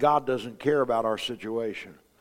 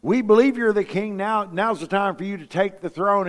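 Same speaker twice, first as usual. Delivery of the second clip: eager crowd cheer; 5 semitones higher, 3 dB louder than usual. Retold or imitated. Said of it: "they were probably going".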